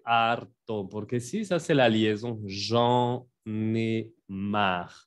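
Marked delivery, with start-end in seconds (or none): 2.5-4.9 "j'en ai marre" spelled out, very slow and syllable by syllable. Shown as a demonstration. Teacher breaking the phrase into syllables, very slowly. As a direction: pull apart its three syllables. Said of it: "Son tres sílabas".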